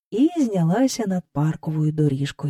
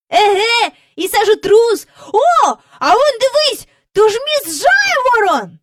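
put-on high, loud girlish cry; excited call; 6 dB louder than usual. A narrator's reading. Jane calling out, spotting the ice-cream man. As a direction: point it out with glee. Jane keeps pointing people out.

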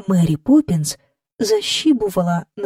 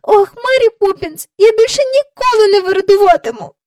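very high, loud tearful wail; sobbing child; 13 semitones higher, 7 dB louder than usual. A narrator's reading. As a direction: wail the promise like a tearful little girl. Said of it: "хлипала вона".

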